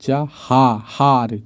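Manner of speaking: चहार stretched out very slowly, syllable by syllable; drawn-out syllables. Shown as a demonstration. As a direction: spell out चहार slowly, each sound separate. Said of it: "चहार दीवारी होता है". (चहार को बहुत धीरे, हर अक्षर अलग-अलग बोलिए)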